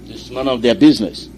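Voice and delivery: loud rallying radio sermon; forceful preaching. Real, not acted. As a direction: drive each line home with force.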